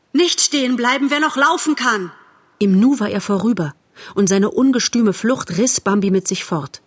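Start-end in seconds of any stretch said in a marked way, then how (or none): 0.1-2.1 high, urgent command; called-out warning. A narrator's reading. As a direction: urge everyone onward in alarm. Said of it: "rief er". (hoch und drängend gerufen)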